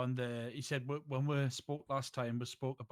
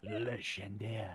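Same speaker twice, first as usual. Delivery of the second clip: put-on French accent; 2 semitones lower, much slower than usual. Repeats name French style.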